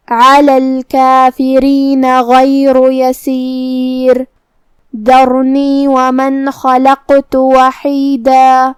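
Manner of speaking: very slow chanted tilawat; Quran recitation. Acted. Teacher re-reciting the verses for her students, slow chant. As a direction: draw each word out in a measured chant.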